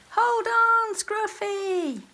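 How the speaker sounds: high, loud shout into the storm. A narrator's reading. Soggy urging Scruffy in the storm, high loud shout. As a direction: shout over the wind. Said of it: "shouted Soggy".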